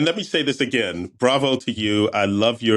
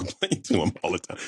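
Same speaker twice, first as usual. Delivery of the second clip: normal talking voice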